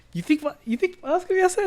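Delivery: father repeating his son's question in disbelief; incredulous. Retold or imitated. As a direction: echo it in disbelief. Incredulous echo of son.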